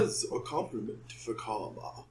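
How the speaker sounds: very low, soft put-on voice; Sidorak impression. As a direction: sink down into a quiet villain voice. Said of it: "That was my Sidorak impression".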